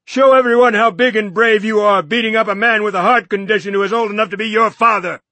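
high, loud, fast sneering taunt; a fast, loud, sneering jab. Acted. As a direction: shame him before the crowd. Norton taunts furiously.

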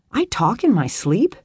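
startled rising question; surprised. A narrator's reading. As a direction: caught off guard.

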